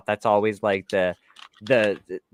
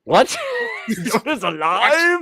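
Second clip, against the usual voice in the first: high, slow, laughing disbelief; acting out Luke's shock that Yoda lives; stunned disbelief; 4 dB louder, much slower than usual. Retelling Luke learning Yoda lives, mock-stunned and laughing.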